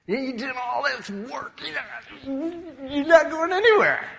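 very high, comic protest; hammed-up high-pitched disbelief; incredulous squeal. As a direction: squeal it in mock exasperation. Mocking futile rocking effort.

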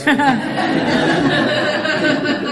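light high-pitched laugh. Real, not acted. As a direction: laughs at his own joke. Laughs at own joke.

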